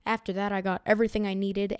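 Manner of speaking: ordinary delivery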